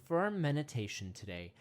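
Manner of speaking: ordinary talk, nothing marked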